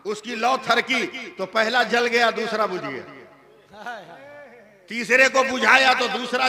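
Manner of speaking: loud, high, driving sermon delivery; preaching at full force. Acted. Preacher telling the crowd about the lamps, loud and driving. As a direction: hammer the miracle story home to the crowd.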